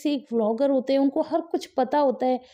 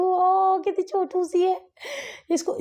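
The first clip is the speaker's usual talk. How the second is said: cooing; 7 semitones higher, slower than usual. Real, not acted. Aunt cooing at her baby niece on screen, slow and high.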